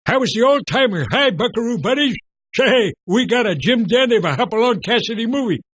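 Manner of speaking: put-on a folksy Western sidekick hollering to the kids; old-timer sidekick. Host revives his Western sidekick character for the kids, in a high, loud old-timer voice. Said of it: "I was a sidekick".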